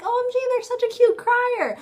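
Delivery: high-pitched gushing imitation; exaggerated adoring squeal in words; mock gushing. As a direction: coo it high, as a mocking admirer. Mimicking admirers of cute criers.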